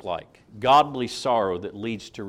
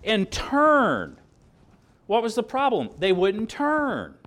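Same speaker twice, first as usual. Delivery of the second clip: emphatic preaching cry; 10 semitones higher, 5 dB louder, slower than usual. Real, not acted. Preacher hammers the point home.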